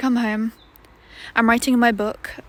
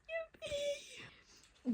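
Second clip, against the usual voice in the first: squeaky high yippee; mock excited; 10 dB quieter than usual. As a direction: cheer like a cartoon. Mock celebration of happy card.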